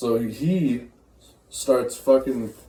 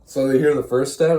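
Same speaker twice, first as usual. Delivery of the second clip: ordinary talk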